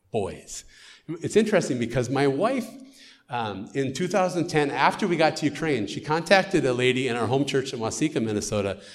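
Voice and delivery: usual speaking voice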